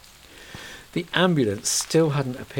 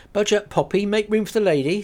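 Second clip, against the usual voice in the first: higher, louder motherly order; Rose voiced, brisk; 10 semitones higher, 7 dB louder than usual. Rose tells Poppy to make room, brisk and motherly. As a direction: hurry the child along. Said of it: "said the other woman".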